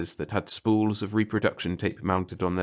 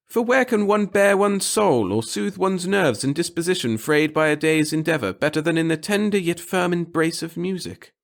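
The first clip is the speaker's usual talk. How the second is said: high, loud, self-important speech; pompous little clerk; 9 semitones higher, 4 dB louder than usual. A narrator's reading. The clerk grandly telling the reporter of his devotion to the center. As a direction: preach your humble service grandly.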